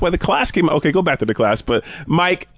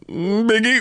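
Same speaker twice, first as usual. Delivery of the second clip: higher, put-on questioning voice; mock pondering; 8 semitones higher, slower than usual. Co-host guessing the islanders' second answer, mock pondering. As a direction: imitate someone reconsidering, pitched up. Imitates islanders reconsidering [name].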